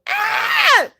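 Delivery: sudden high yelp of pain; a short, high scream, imitated from memory; retold scream. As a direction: cry out sharply, as if woken by pain. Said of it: "and I just screamed".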